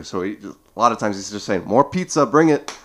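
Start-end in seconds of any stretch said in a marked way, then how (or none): 1.7-2.8 mock demand. Quoting fighter demanding pizza.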